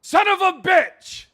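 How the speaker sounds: loud, high, slow angry yell; furious outburst. Acted. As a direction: explode in outrage. Chief upset speech was missed.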